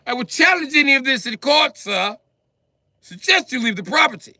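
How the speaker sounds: high, loud, haughty proclamation; pompous little lord. Acted. Midget barricaded in his house, lording it over the cops. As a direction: declare it like a tiny king in his castle. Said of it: "like I'm Peter Dinklage".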